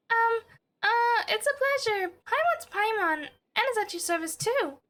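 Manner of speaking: high, chirpy little companion voice; Paimon voice. Acted. Streamer voicing Paimon's greeting to the Fontaine noble, high and chirpy. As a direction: pitch way up like a tiny fairy sidekick.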